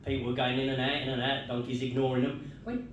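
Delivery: ordinary talk, nothing marked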